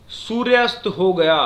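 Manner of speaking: loud, slow सूर्यास्त हो गया, then a stop; semicolon pause. Shown as a demonstration. Teacher demonstrates the semicolon, stopping longer after सूर्यास्त हो गया. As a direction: announce सूर्यास्त हो गया slowly and halt after गया. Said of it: "अब हम रुके".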